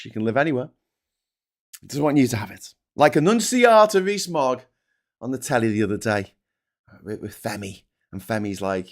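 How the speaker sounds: usual speaking voice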